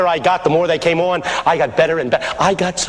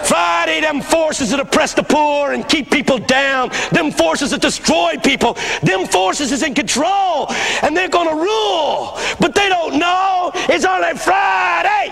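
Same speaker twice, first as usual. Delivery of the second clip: high, slow, shouted preaching; fervent old pastor; 9 semitones higher, slower than usual. Retold or imitated. Old pastor decries forces that oppress the poor, fervent preaching. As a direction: build to a drawn-out pulpit cry. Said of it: "should have heard him do it".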